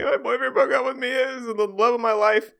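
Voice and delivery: put-on high, wailing heartbroken girl voice; mock sobbing student. Retelling a student crying to her professor, high and wailing. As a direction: whine it like a dumped student.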